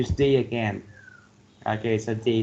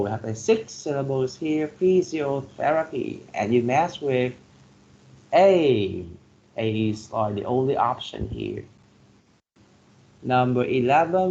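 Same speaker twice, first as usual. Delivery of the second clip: usual speaking voice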